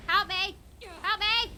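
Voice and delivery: loud, high cry for help; calling for help. Real, not acted. Player yelling at followers in combat, loud plea. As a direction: shout to your allies across the fight. Calling companions mid-fight.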